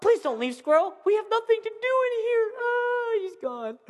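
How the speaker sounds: high, whiny fake-crying plea; hammed-up sobbing passenger; mock whimpering. Mock despair on stuck plane.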